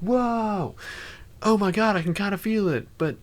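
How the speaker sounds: high, fast, gushing amazement; giddy mock excitement. Streamer voicing the player giddy to the old mentor, high-pitched gushing. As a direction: squeal like a starstruck apprentice.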